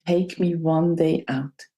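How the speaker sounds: normal talking voice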